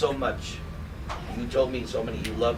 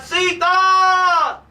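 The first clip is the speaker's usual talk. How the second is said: much higher, louder, drawn-out call of grief; anguished cry; 6 dB louder than usual. Retold or imitated. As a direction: cry out for the lost wife. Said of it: "He was crying out".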